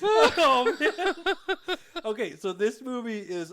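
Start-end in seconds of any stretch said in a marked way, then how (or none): 0.0-1.8 high, louder laughing sigh; catching breath after a laughing fit. Real, not acted. Recovering from laughter with his cohost, laughing.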